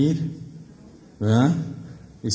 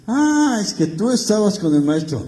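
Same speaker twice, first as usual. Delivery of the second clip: high, loud gotcha accusation; accusing bystander; 9 semitones higher, 6 dB louder than usual. Acted. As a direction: point the finger at him.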